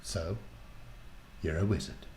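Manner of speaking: hushed, halting realisation; soft, deliberate and wary; slowly. A narrator's reading. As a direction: pause after the first word, softly working it out. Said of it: "she said slowly".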